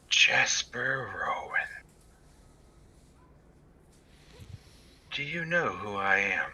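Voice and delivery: airy whisper on the wind; breathy whisper. Acted. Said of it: "breathy voice, almost like a whisper on the wind".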